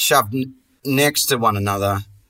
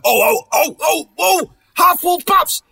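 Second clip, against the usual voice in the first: high, loud, delirious whooping; overexcited shouting; 5 dB louder, slower than usual. Retold or imitated. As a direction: whoop like a hyped-up salesman. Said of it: "But when I was like".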